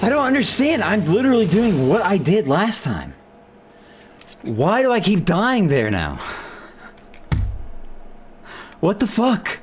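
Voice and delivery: can't believe it's happening again; exasperated. Real, not acted. Gamer baffled at dying to the boss again, exasperated.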